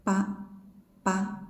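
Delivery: "Pa" voiced like ba, no breath. Shown as a demonstration. Teacher demonstrating voiced unaspirated sound. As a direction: voice it, no puff. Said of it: "Voiced without breath".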